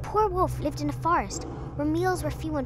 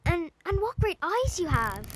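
put-on higher, hesitant little-girl voice; wondering little girl; 7 semitones higher than usual. A narrator's reading. Girl to disguised wolf, higher and unsure. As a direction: stumble a little, growing uneasy.

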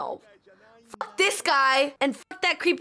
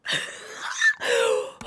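high, breathy gasping; real excited gasp; overwhelmed gasp; 11 semitones higher than usual. Real, not acted. Overwhelmed by the fight.

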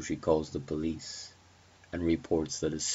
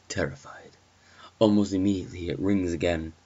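ordinary delivery, nothing marked

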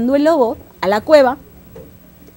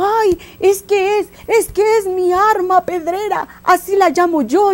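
frightened donkey; 9 semitones higher, 4 dB louder than usual. Acted. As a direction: plead it high-pitched in acted fright. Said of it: "El burro asustado le dijo".

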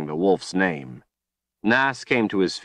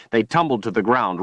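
ordinary delivery, nothing marked

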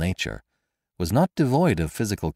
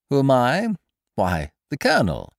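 mocking stranger; 6 semitones higher, 3 dB louder than usual. A narrator's reading.

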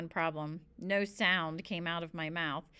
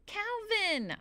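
high-pitched calling yell; 13 semitones higher than usual. A narrator's reading. Stuck boy yells to Calvin from the tree, yelling high. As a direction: call out for help, pitch way up. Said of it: "I yelled".

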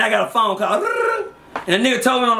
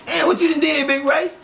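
higher, shouted fake grief over Big Ray; mock dismay; 6 semitones higher than usual. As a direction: ham up shock at the loss. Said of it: "I couldn't even act like".

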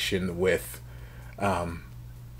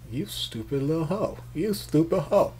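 mock insult; 7 semitones higher than usual. Mama insulting the girl.

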